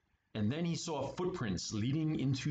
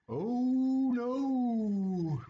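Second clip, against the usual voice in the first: higher, louder ghostly wail; 8 semitones higher, 6 dB louder than usual. Acted. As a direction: moan it like a spooked ghost. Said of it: "wailed Ghost".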